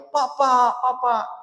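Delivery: put-on high, urgent child calling out to father; child calling papa. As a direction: call out like a small child running in. (anak kecil memanggil ayahnya dengan suara tinggi)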